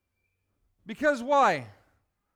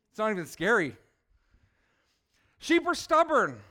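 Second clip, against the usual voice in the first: ordinary delivery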